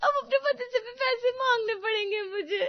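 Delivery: high, loud, drawn-out wailing; bitter sobbing. Acted. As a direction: wail the complaint through theatrical sobs. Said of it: "फूट फूट कर रोने लगती है".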